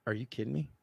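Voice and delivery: very soft, private aside; acted hushed incredulity; retold muttered disbelief. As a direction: mutter it under your breath in dismay. Muttered to himself, no roll.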